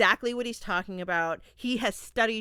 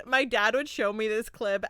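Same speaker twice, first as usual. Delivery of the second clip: ordinary delivery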